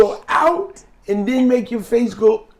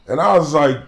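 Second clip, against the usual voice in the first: ordinary talk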